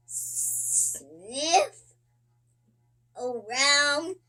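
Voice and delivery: usual speaking voice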